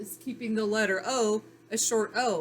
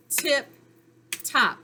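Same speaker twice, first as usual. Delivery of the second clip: "tip, top" with a clear gap between the beats; clapped syllables; 4 semitones higher, 4 dB louder than usual. Shown as a demonstration. Teacher clapping out the word, "tip, top" split apart. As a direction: punch "tip", pause, then punch "top". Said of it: "if we clap it out again".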